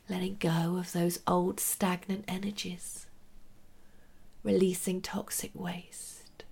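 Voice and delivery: soft whispered meditation guidance; right in your ear. Acted.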